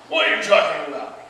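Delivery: loud acted protest; affronted warrior, loud; indignant.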